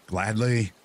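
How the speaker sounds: high, bright joyful cry. Acted. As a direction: answer overflowing with joy. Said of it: "cried the wife, overflowing with joy".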